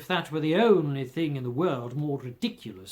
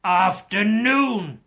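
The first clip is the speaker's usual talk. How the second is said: slow, louder final goodbye; drawn-out dismissal; 4 semitones higher, 7 dB louder, much slower than usual. Acted. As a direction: drag it out to end the talk. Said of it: "said Scrooge".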